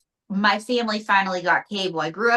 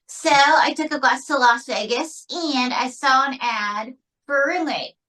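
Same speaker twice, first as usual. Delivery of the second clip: higher-pitched ventriloquist's puppet voice; raised, put-on puppet storytelling; puppet Mae; 5 semitones higher than usual. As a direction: tell a life story as a showbiz puppet. Puppet Mae tells her life story.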